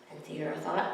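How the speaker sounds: ordinary delivery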